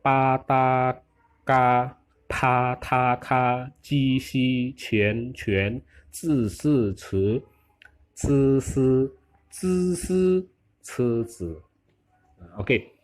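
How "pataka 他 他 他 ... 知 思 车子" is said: slow separate syllables on level tones; repeated pronunciation drill. Shown as a demonstration. Teacher repeating the consonant table for students, deliberate drill. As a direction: chant out each consonant evenly. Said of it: "Oke, kita ulangi ya".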